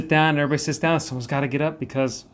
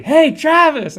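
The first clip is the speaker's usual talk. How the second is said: much higher, much louder yell of the name; 11 dB louder, much slower than usual. Retold or imitated. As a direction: shout his name across the room at the screen. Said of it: "he yells practically".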